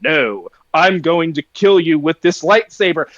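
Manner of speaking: very low mock-villain voice; Dooku. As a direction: imitate a movie villain's low monotone. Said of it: "And Dooku's like".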